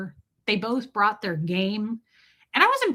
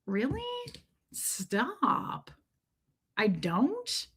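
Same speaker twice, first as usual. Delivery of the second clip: soft, coy and hushed; flattered disbelief; 4 semitones higher, 8 dB quieter, much slower than usual. Retold or imitated. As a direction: melt softly at the compliment. Disarmed, flattered by the man's remark.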